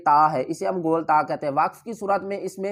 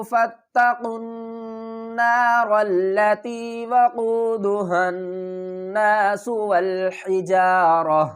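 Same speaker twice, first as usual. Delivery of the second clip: slow, drawn-out melodic chant; Quran recitation; much slower than usual. Shown as a demonstration. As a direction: recite the verse in long measured phrases. Teacher recites the verse. (ترتیل کے ساتھ لمبی تلاوت)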